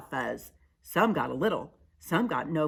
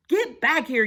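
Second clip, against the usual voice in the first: high, loud command; yell; 10 semitones higher, 8 dB louder than usual. Acted. Pip calling the fleeing crowd back, yelled. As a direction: yell after scattering friends. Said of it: "yelled Pip".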